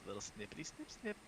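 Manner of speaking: put-on very high playful baby talk; high silly voice. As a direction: coo the nonsense words high. Silly baby-talk snipping words.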